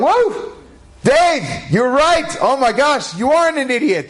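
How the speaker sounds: high-pitched, hammed-up cheering; jubilant exclaiming. Pharmacy staff cheering Dave's won bet, jubilant. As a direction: whoop it up like the crowd at a won bet.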